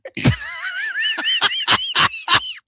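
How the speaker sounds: real high-pitched squeal of laughter. Real, not acted. Host cracks up at answer.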